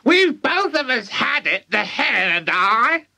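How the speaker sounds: put-on high screechy old woman; boastful high granny holler; shouting granny. A narrator's reading. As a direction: crow it from the rooftop. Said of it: "Grandma shouted".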